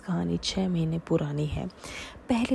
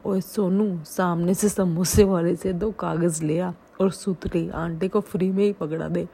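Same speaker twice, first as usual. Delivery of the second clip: louder, hammed-up shopkeeper call; 6 dB louder than usual. Shopkeeper yelling the punchline to his assistant, mock loud call. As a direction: holler the punchline across the shop.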